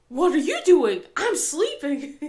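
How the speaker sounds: exasperated comeback, played up and loud; mock indignant retort. Sick mom imagining her reply to her daughter, mock indignant.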